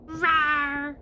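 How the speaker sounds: loud playful roar; big mock-monster rawr; dinosaur roar. Player roars as dinosaur.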